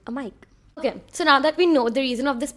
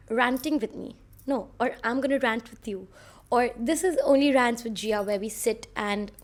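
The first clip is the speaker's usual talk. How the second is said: ordinary delivery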